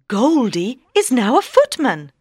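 ordinary delivery

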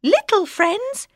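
a proud little mouse. Acted. Mouse tells the lion the moral, high.

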